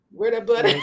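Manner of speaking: high, laughing question; laughing tease. Real, not acted. Guest teases the host about her bun, laughing.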